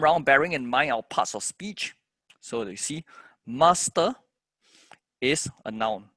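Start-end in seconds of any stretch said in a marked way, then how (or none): 3.4-4.1 "master" pitched up, first syllable strong; stress on MASTER's first syllable. Shown as a demonstration. Teacher showing noun stress, first syllable of "master" strong. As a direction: hit the start of "master". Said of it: "stress on the first syllable".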